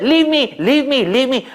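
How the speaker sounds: high and loud, frantic begging; a demoness crying out to be let go; shrill desperate leave me; panicked pleading. Acted. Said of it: "She said".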